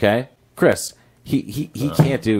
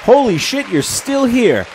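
mock surprise; 12 semitones higher, slower than usual. Game host surprised contestants are still there. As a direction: feign shock that they stayed.

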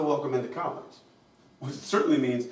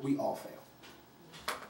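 breathy, slow whispered "we all fail"; 10 dB quieter, much slower than usual. Real, not acted. Speaker to audience, whispering the hard truth slowly. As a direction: drop to a hush, let it sink in. Hushed before plain repeat.

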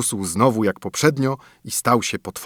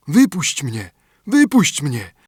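calling out; 5 semitones higher, 4 dB louder, slower than usual. A narrator's reading. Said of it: "znowu głos zawołał".

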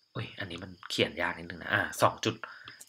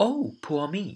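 "Oh" pitched high and rising into mock dismay; little exclamation; 10 semitones higher, much slower than usual. Shown as a demonstration. Teacher modelling the exclamation for her class. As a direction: sigh out a cute high "Oh". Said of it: "อุทานนิดนึงนะ".

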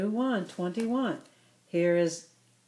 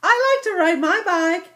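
put-on higher, louder happy girl voice; cheerful girl calling; 10 semitones higher, 7 dB louder than usual. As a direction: call out to your friend while riding. Irene talking to Ilex.

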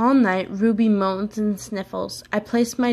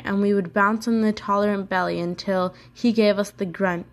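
usual speaking voice

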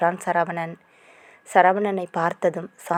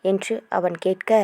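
ordinary delivery, nothing marked

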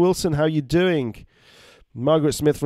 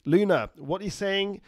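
ordinary talk

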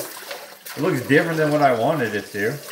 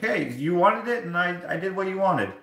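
higher-pitched defensive mimicry; mock defensive barber; 6 semitones higher than usual. Barber defends his haircut to the customer, mock defensive. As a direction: imitate him shrugging off the blame, pitched up.

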